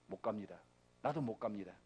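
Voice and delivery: low, soft, heavy-hearted plea; hushed refusal. Acted. Moses telling God he cannot go, hushed. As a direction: drop right down to a quiet refusal.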